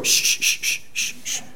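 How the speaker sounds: string of soft shushes; kids' shushing. Retold or imitated. Children shushing their parent's problems away, soft repeated shh. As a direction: hush repeatedly, imitating a child. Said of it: "then our kids go".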